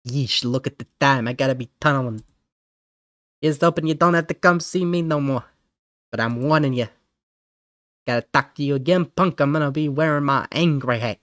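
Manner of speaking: lightly voiced mobster-like scolder; scolding tough guy. Tough guy warning a troublemaker not to come back, scolding. Plays gruff scolding game character.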